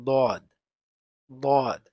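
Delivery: very loud, heavy দদ from the tongue's edge; emphatic dad. Shown as a demonstration. Teacher announcing the letter দদ, very loud. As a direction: press দদ hard, tongue edge to the gums. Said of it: "দদ উচ্চারিত হয় জিহ্বার গুড়ার কিনারা".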